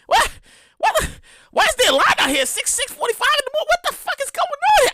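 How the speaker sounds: seeing a long line at dawn in high disbelief; high-pitched disbelief. Retold or imitated. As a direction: yelp in disbelief. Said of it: "I'm like".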